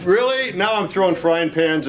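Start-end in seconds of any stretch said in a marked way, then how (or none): 0.0-0.5 incredulous wife. Retold or imitated. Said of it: "now you're like".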